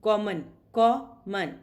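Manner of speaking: "Common" drawn out slowly, syllables split; slow syllable demo. Shown as a demonstration. Teacher to students counting syllables, slow split syllables. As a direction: tap out "Common" slowly in two parts. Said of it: "So there are two, two syllables".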